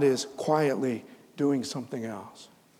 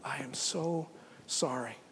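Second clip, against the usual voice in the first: whispered sorrow; 12 dB quieter, slower than usual. Acted. God whispering his sorrow to Adam and Eve. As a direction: whisper the apology. God tenderly sending them away.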